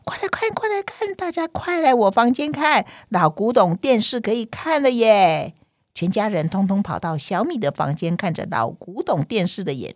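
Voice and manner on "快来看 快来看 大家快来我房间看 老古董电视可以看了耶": excited shout. Acted. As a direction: call the family in with excitement. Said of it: "小米就兴奋地大叫".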